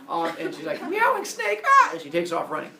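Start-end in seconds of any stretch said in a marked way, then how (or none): 0.9-1.9 high, loud frightened cry; mock panic. Leto fleeing the meowing snake, panicking. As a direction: yell it in cartoon terror.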